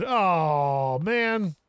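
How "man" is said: normal talking voice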